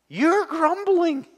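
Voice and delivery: high, slow mock accusation; accusing voice. Voicing John to grumbling Israel, mock accusing. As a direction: point the finger, drawn out and high. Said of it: "He's, he's saying".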